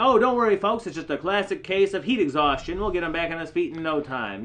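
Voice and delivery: hammy dance-marathon emcee reassuring the crowd. Retold or imitated. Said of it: "starts saying".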